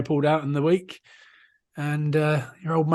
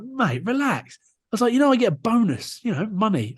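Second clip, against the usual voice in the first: high-pitched mock pleading; retold wheedling; 8 semitones higher than usual. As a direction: talk an official round, pitched up. Pleading with the touch judge.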